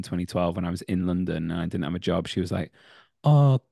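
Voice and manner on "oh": put-on Filipino accent. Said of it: "I'm not gonna do the accent".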